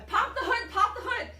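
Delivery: high, loud repeated command; urgent order. Retold or imitated. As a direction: call it out insistently. Mechanic ordering her urgently.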